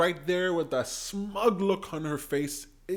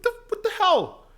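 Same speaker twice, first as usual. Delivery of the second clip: 1 high exasperated yelp; 14 semitones higher, 4 dB louder than usual. Real, not acted. Exasperated outburst.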